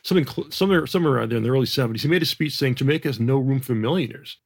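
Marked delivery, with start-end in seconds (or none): none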